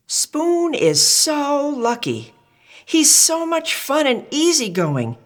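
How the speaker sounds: a high-voiced friend sighing in envy; high envious character voice. Friend's voice envying Spoon.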